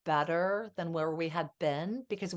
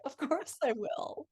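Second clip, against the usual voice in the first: higher, sweet and slow; sweet reply; 7 semitones higher, slower than usual. Retold or imitated. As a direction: melt as you answer him. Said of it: "And I'd be like".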